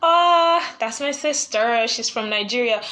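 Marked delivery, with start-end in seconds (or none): 0.1-0.8 loud, high "Ah"; delighted recognition; excited exclamation. Real, not acted. Host recognises her sister's comment, excited outburst.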